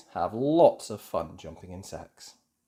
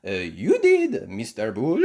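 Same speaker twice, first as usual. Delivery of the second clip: French teacher voice; 8 dB louder than usual. A narrator's reading. Madame Gazelle answers.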